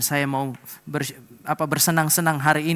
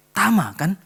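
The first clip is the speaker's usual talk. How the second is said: louder, higher second call of Tama kan; 5 semitones higher, 8 dB louder, slower than usual. Retold or imitated. Mother calling her son's name again, louder and higher.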